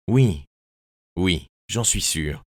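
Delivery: a slow, hesitant answer; faltering yes after a long pause. A narrator's reading. Lightly voiced cousin, hesitating, unsure she was sent. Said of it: "Elle hésita quelques secondes".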